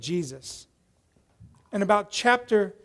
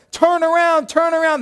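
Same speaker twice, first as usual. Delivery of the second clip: high, loud yell at a runaway teammate; 9 semitones higher, 6 dB louder than usual. Retold or imitated. Teammates frantically call to the wrong-way runner, yelling. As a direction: imitate players yelling to stop him. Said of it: "They're all yelling at him".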